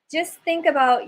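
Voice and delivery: normal talking voice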